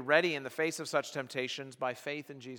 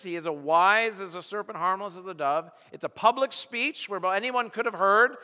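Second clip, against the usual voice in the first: high, loud, driving sermon voice; full-force preaching; 7 semitones higher, 9 dB louder than usual. Acted. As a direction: preach it out to the whole hall. Preacher telling David's story loudly.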